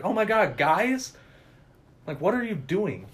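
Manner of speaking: high surprised exclamation, lightly voiced; mock delighted girl greeting the boys; excited Allison. Retelling the film, Allison greets the sneaking friends in mock surprise.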